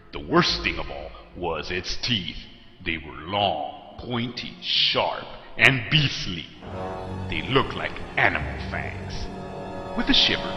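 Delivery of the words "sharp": usual speaking voice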